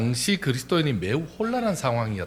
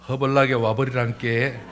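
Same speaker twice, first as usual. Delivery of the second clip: folksy Jeolla accent; Jeolla dialect; 3 dB louder than usual. Shown as a demonstration. Welcoming someone as in Jeolla, Jeolla accent. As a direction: say it like a Jeolla local. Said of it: "전라도는".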